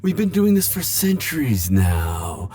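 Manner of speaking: mock heartbreak. Teasing the Reaper, high mock heartbreak. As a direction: pout it out, pretending to be wounded.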